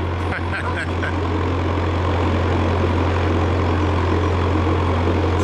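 long breathless unvoiced laugh; real long airy laugh at his own joke; big laugh. Real, not acted. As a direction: crack up without voice, all breath.

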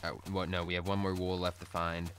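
normal talking voice

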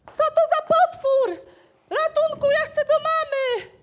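high, loud scared yelling; terrified shout. Acted. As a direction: scream for your mother. Boy terrified of monster.

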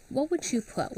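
usual speaking voice

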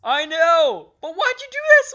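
very high, loud protest; exasperated yell. Real, not acted. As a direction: shriek the complaint at your teammate. Protesting teammate's blunder in game.

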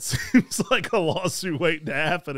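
amused, laughing through the joke. Real, not acted. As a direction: let a laugh break into the line. Joking about lawsuit, laugh heard.

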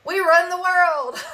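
high, drawn-out and laughing; laughing boast. Real, not acted. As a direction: crow it through real laughter. Jokes about control freaks, cracking up.

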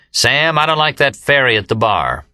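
put-on a drunk announcing loudly across the room. A narrator's reading. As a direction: say it for the whole bar to hear. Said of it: "in a loud voice".

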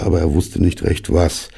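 ordinary delivery

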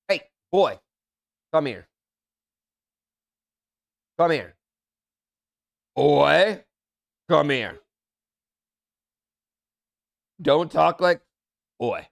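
loud dad calling his son over. Real, not acted.

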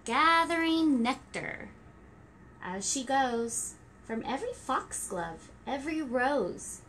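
gentle rhyming storybook read; reading to children. Acted. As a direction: read it like story time for toddlers. Reading rhyming picture book.

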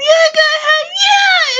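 very high, squealed encouragement; hammed-up eager go-ahead. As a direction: squeal it out playfully. Acts out handing over drinks.

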